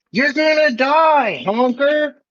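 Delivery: high, loud in-character scream; screaming threat. Acted. Clown charging the barricades, screaming. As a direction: scream it as a drawn-out battle cry. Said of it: "what if we just ran in screaming".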